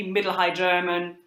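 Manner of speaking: ordinary delivery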